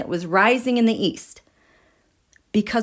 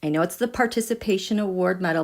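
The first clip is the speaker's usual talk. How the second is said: ordinary delivery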